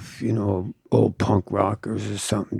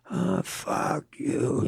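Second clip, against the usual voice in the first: soft, slow, higher-pitched grumbled retort; mock drunken rant; 5 semitones higher, 10 dB quieter, slower than usual. Wino to the tattooer throwing him out, imitated rant. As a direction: draw it out like a muted wino's rant. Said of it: "he starts fucking ranting and raving".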